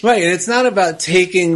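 ordinary talk

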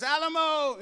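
mock cannonball yell; jump yell. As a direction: call it out mid-jump. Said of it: "they would yell something".

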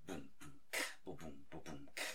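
low mouth-drum beat; beatbox a boom bap drum pattern. Shown as a demonstration. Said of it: "Boom bap".

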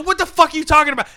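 fast, high outraged demand. Acted. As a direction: snap at him in disbelief. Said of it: "He said".

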